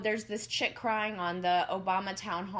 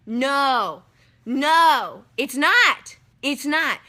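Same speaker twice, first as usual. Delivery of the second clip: emphatic no; 5 semitones higher, 9 dB louder, much slower than usual. Real, not acted. Insists airline won't cover it.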